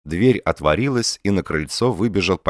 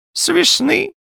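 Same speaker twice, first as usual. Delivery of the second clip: put-on high, louder old man's voice; reply plaintively, pitched way up; high-voiced lisping old man; old man answering; 5 dB louder, slower than usual. A narrator's reading. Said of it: "ответил старик".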